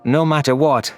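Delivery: usual speaking voice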